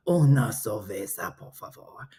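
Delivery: low, soft Spanish man; imitating a suave low Spanish voice; Spanish guy impression. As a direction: drop the pitch, trail into a whisper. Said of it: "That's how he sounds".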